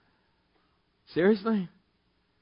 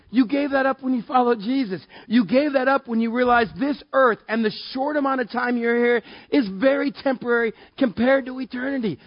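full preaching; 7 semitones higher than usual. Acted. Preacher presses the verse on the congregation, fervent. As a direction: preach it with fire.

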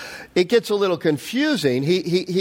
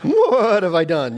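mock donkey voice; donkey talk; slower than usual. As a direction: play the talking donkey, half laughing. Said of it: "I don't know how you do, like, donkey talk".

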